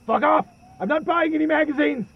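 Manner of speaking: high, loud furious yell at the door; shouting furiously through a closed door; angry shout. Acted. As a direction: drive the salesman away with a yell. Recluse yelling through the door.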